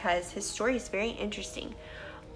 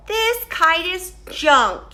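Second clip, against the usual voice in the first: slow, louder Toad voice; glum Toad; 7 semitones higher, 7 dB louder, slower than usual. Acted. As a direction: drag it out like a beaten little toad. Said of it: "he said".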